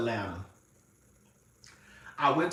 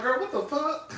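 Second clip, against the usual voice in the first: high, stunned disbelief; mock shock; 10 semitones higher than usual. Recalling the shedding coat to viewers, high mock disbelief. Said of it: "And then I'm like".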